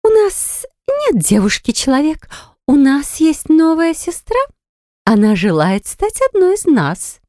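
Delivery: high, slow fairy queen; slow, eerie and regal. A narrator's reading. Pixie queen speaks.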